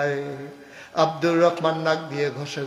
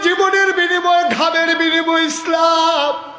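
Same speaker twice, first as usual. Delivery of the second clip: high, loud impassioned chant; full-force preaching; 14 semitones higher, 10 dB louder than usual. Real, not acted. Preacher to crowd on Islam's cost, full-force chant. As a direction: cry it out to rouse the crowd.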